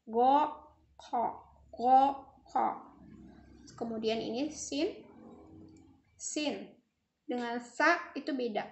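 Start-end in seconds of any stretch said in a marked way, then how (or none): 0.0-2.8 "Go" voiced and louder, "kha" rough and unvoiced; ghain and kha contrast. Shown as a demonstration. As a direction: bark "Go" then scrape "kha". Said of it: "pengucapannya harus bisa".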